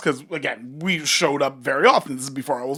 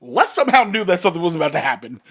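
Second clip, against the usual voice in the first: ordinary delivery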